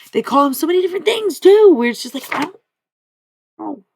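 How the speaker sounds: high, loud, fast burst of confusion; worked up and baffled, voice shooting up; exasperated. Real, not acted. Venting confusion over his many names.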